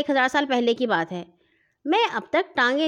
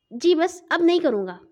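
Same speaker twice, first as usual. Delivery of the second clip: flustered; 7 semitones higher than usual. A narrator's reading. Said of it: "گھبرا کر کہا".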